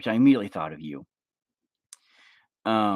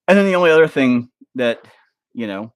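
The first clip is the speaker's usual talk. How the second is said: ordinary delivery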